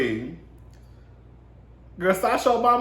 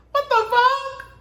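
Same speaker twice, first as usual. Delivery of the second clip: shocked disbelief; 4 dB louder, slower than usual. Retold or imitated. Shocked at hidden camera.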